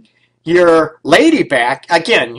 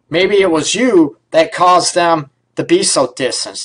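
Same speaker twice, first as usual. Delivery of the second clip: usual speaking voice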